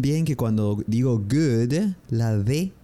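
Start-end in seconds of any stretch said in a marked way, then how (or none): 1.2-1.9 GOOD with a firmly released final D; drawn-out GOOD ending in a clear D; strong final D. Shown as a demonstration. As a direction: say GOOD slowly, landing hard on the D. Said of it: "un poco más fuerte que en español".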